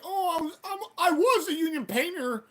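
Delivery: high, whiny mimicry of an excuse; mock his protest way up high; mocking painter. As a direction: imitate him stammering in a high voice. Mocks the painting guy's excuse.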